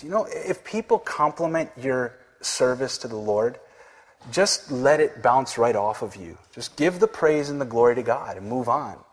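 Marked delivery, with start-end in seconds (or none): none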